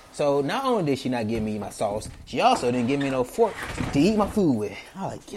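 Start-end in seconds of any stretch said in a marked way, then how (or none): none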